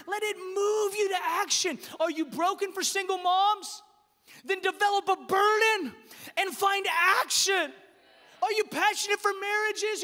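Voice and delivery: high, loud, shouted sermon; preaching at full force. Acted. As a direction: hammer home the burden to act.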